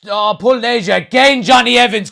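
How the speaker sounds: loud indignant outburst; outraged shout. Real, not acted. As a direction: yell it in disbelief at the foul. Protesting a foul on Evans.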